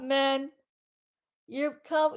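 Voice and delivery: ordinary talk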